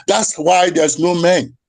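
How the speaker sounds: normal talking voice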